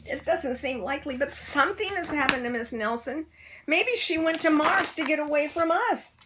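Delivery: put-on high, fretful child voices; worried kids. A narrator's reading. Kids guessing what happened, high and worried. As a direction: fret and speculate anxiously.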